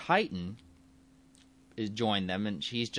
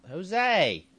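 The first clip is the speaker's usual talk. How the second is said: calling out; 11 semitones higher, 7 dB louder than usual. Real, not acted. Calling out to the pet.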